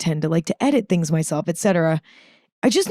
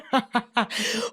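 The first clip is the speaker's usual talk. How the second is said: short, breathy giggle of disbelief; a quick laugh at herself; startled giggle; 4 semitones higher, 7 dB quieter than usual. Retold or imitated. Retelling her surprise at tearing up, a startled giggle.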